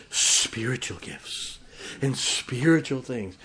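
slow, drawn-out eerie emphasis; put-on mystical hush on drawn-out words; mock spooky. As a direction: stretch it out spookily, poking fun. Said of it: "We wanna sound real spooky".

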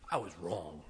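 soft, low confession; humble admission. Retold or imitated. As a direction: quietly own the mistake. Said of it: "You gotta go to a child and say".

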